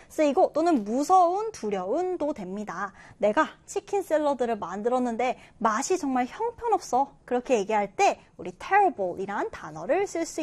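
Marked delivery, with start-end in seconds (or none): none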